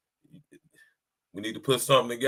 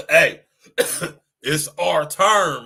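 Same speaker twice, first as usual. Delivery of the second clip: slow, louder "it's our turn"; mock gangster declaration; quoting the gangster; 5 semitones higher, 8 dB louder, slower than usual. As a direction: drag out "turn" like a threat. Reciting the movie line.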